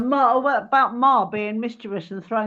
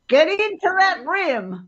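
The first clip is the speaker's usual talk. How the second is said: drawn-out cheer; 9 semitones higher, 3 dB louder, slower than usual. Acted. Cheering the meteor shower.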